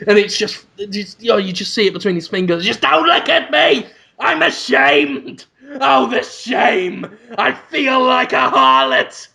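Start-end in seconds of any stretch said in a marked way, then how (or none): 2.6-9.3 slow, higher-pitched mock wail; hammed-up shame. Salesman exposed in the dealership, hammed-up anguished shame. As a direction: wail it out in theatrical humiliation. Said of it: "he runs to the bathroom and cries".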